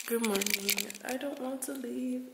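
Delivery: ordinary talk, nothing marked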